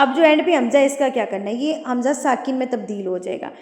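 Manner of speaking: usual speaking voice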